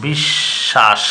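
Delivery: বিশ্বাস said as "bishshash" with a doubled sh; pronunciation demo. Shown as a demonstration. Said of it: "উচ্চারণ হবে এমন". (দ্বিত্ব শ দিয়ে বিশ্বাস)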